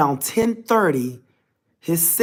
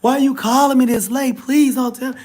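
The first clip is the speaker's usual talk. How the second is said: put-on crying girlfriend; 8 semitones higher than usual. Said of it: "she just started crying".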